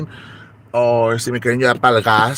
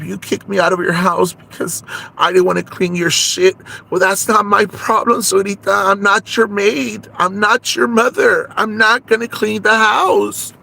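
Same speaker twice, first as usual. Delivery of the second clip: indignant scolding; 7 semitones higher than usual. Acted. Telling off the mother-in-law for kicking her out, indignant. Confronts Suelita over eviction.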